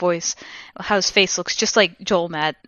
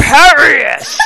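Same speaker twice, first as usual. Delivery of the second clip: high, drawn-out hammed-up shout; shouted spell; 10 semitones higher, 11 dB louder, much slower than usual. A narrator's reading. Villain casting a curse at the heroine, hammy drawn-out shout. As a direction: bellow the spell slowly, theatrically.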